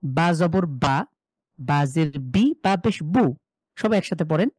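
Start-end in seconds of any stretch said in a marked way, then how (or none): 0.0-3.3 slow, syllable by syllable spelling; slow spelling drill. Shown as a demonstration. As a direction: spell each sound out slowly for students. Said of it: "এটাকে যদি টান ছাড়া দ্রুত পড়েন". (ধীরে ধীরে বানান করে পড়া)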